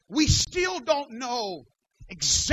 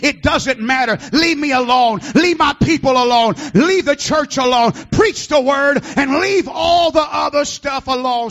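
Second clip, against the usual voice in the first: building to a loud preached climax over the organ; fiery sermon climax, shouting it out; preaching at full force; 10 dB louder than usual. Real, not acted. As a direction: rise from firm to shouting, hammer each line. Preaching at full force, music behind.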